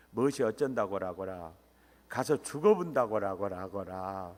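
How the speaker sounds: low Jeolla-dialect protest. Acted. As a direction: play Peter objecting in broad Jeolla dialect. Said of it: "전라도 버전으로 이렇게 한 거예요". (전라도 사투리로 항변하듯)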